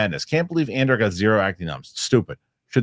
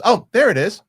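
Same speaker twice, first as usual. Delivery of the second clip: high, a bit louder mock 'found it'; delighted discovery; 12 semitones higher, 6 dB louder than usual. Host acting Chit finding the purse, high and loud. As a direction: sing out the find like a fool. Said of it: "And then he goes".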